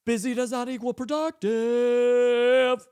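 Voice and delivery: drawn-out booming declaration; emphatic repeat for the Catholics. Shown as a demonstration. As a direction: preach it slow and loud like a pulpit proclamation. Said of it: "Let me say it for the Catholics".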